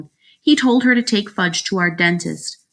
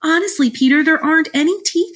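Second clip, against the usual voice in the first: put-on high girlish insistence; protesting girl; 11 semitones higher, 4 dB louder than usual. A narrator's reading. Sheila tells the brother she found nothing, high protest. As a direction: insist in a high, upset girl's voice.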